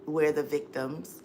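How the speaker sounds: ordinary talk, nothing marked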